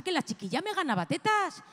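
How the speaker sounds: usual speaking voice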